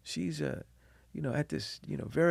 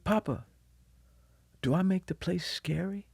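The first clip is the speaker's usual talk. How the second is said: quietly voiced child's question; soft, tender quote; 4 semitones higher, 8 dB quieter than usual. Father quoting his daughter's question to him, softly.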